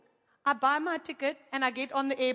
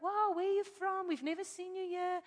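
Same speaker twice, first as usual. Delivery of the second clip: high, softened mock amazement; put-on wonder at a stranger; imagined amazed welcome; 5 semitones higher, 3 dB quieter than usual. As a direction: fuss over a newcomer in a higher, lighter voice. Imagines curious locals fussing over her.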